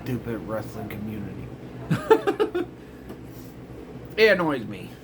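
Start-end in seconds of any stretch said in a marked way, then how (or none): 0.0-1.5 hushed, laughing scorn; whispered eye-roll; whispered disgust. Retold or imitated. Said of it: "I'm like". 1.5-2.7 squeaky high-pitched giggle; quick falsetto laugh. Real, not acted. Laughs at own rant.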